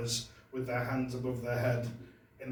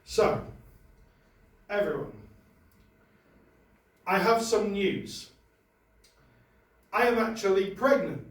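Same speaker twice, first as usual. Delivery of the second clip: mock-solemn prepared statement, higher and louder; formal statement; 3 semitones higher, 5 dB louder than usual. Comic reading a prepared statement to the audience, mock-formal. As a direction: announce it like a press release.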